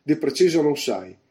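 indignant parroting; mocking echo. A narrator's reading. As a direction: throw his answer back at him. Throws the sailor's answer back scornfully.

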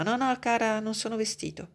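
a touch of hoarseness, up high; hoarse voice. A narrator's reading. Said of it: "una voce rauca del comico".

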